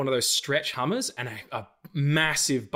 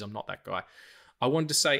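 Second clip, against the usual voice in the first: ordinary talk, nothing marked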